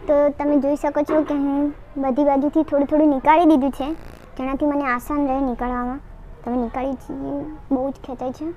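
talking with a stiff mask; speaking while mask dries; wearing a peel-off face mask. Real, not acted.

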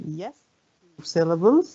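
usual speaking voice

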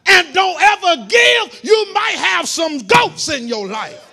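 loud, rising preacher's cry; preaching shout. Acted. Preacher warning the congregation, loud impassioned preaching. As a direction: bring it home to the church at full force.

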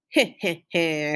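put-on low, soft, slow old-woman heh heh heh; low chuckle. Gloria chuckles unseen as the dog eats, low soft laugh. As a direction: chuckle softly to yourself from hiding.

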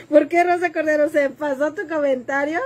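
real laugh spilling into the question. Real, not acted. Laughs reading viewer's comment.

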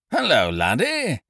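genial Ukridge. A narrator's reading. Ukridge greeting his friend outside the theatre, genial. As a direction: boom a jolly hello to an old pal. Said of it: "said Stanley Fanshawe Ukridge genially".

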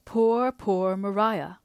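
slow, aching repetition of pity; tender. Acted. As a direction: draw out each poor with grief. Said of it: "replied Mister Hale tenderly".

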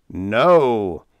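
loud, indignant outburst; snapped. A narrator's reading. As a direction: snap back with force. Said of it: "he snapped".

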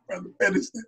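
unvoiced timid murmur; sheepish whisper. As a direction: shrink back and whisper. Sheepish reply to minister.

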